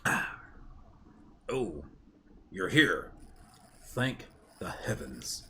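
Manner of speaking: put-on a gruff old soul, relieved, understated. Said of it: "Oh no, it's Mister Gruff Voice".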